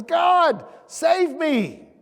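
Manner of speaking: high, loud plea for rescue; crying out to God. Retold or imitated. Man hanging off a cliff crying to God, high loud plea. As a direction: call out to God as you slip. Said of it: "he cries out to God".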